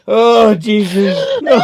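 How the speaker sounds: loud, high, drawn-out shout; a startled cry of dismay; shocked outcry. Real, not acted. Something breaks, loud outburst.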